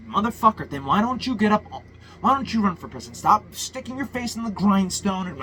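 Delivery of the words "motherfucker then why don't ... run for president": ordinary talk, nothing marked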